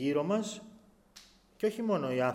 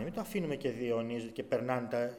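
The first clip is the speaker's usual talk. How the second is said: normal talking voice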